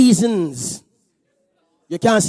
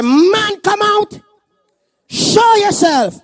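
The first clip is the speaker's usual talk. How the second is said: commanding shout; 9 semitones higher, much slower than usual. Pretend. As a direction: call the man out like an exorcism. Casting out, commanding with hands.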